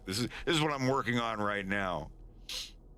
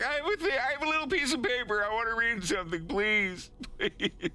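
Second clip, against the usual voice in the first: high, rapid pleading; desperate high-pitched rush; begging; 13 semitones higher than usual. Acted. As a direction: plead frantically for a chance to read. Wolf begging to read his paper.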